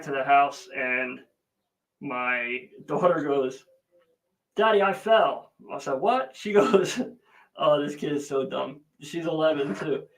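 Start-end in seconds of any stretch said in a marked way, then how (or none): 4.6-5.5 put-on higher, louder little-girl voice; daughter's voice. Daughter voiced in retold story. 5.9-6.4 sharp high surprised what; retold surprised what. Reacting in retold story.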